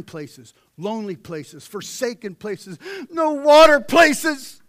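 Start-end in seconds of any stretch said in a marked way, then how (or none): 3.1-4.6 sudden high, loud slow swell; drawn-out preacher's shout; full-force preaching. Real, not acted. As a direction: cry it out slowly from the pulpit. Preaching swells on last item.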